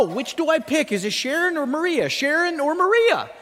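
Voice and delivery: high-pitched frantic dithering; going crazy. Acted. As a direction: agonize comically between two choices. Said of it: "he was going crazy with this".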